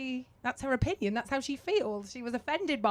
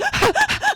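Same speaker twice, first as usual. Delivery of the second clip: panting laugh; 8 dB louder than usual. Retold or imitated. Panting on the bike.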